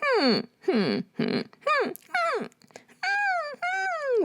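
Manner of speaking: rising soft whimpers, slowing; tiny high puppy whimpers. Shown as a demonstration. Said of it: "tiny little vocal cord edge connection".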